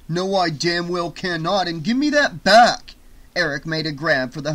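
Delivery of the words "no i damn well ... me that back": raised voice, building to a high shout; refuse, then demand it loudly; outraged, climbing in volume; indignant anger. Acted. Eric refuses and demands hubcap.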